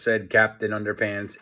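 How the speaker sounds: plain reading voice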